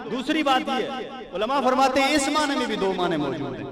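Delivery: normal talking voice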